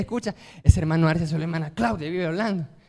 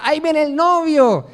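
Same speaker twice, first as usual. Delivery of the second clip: higher, louder announcing cry; retold shout; 8 semitones higher, 6 dB louder than usual. Wedding guests announce the groom's arrival, loud joyful cry. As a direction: call it out like a herald. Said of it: "Después se gritaba".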